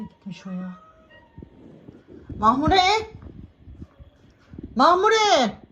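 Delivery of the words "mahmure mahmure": high, loud call across the house. Real, not acted. Woman calling her cat loudly from another room. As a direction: call the cat to come back.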